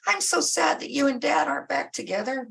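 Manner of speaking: put-on high whiny teen complaining; pouty teenager dodging cleaning her room, high and whiny. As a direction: whine like a sulky teenager who wants out of chores.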